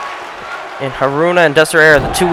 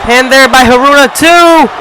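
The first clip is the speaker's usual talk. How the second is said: excited; 6 semitones higher than usual. Real, not acted. Near fall during the match.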